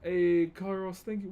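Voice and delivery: ordinary talk, nothing marked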